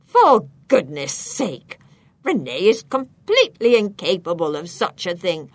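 loud, indignant outburst; angry granny. A narrator's reading. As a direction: protest forcefully as an outraged grandmother. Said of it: "Granny's anger flares".

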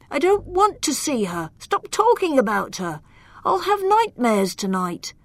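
put-on high, fretful young voice; timid knight. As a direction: plead like a frightened boy. Timid knight begs him to stop.

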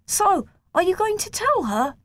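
put-on high boyish voice, teasing; teasing friend. A narrator's reading. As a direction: nudge him playfully.

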